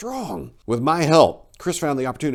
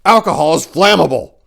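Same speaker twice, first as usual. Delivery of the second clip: exasperated shout; 5 semitones higher, 10 dB louder than usual. A narrator's reading. Exasperated reply in the chaos.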